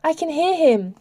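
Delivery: high-pitched, earnest insistence; lifted, pleading protest; insistent. A narrator's reading. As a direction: insist on being believed. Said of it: "she insisted".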